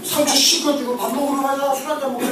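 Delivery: breezy invitation. Retold or imitated. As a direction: play the offender acting as if nothing happened.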